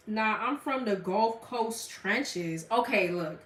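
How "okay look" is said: ordinary talk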